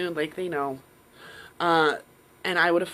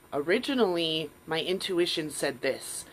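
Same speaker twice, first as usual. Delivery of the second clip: normal talking voice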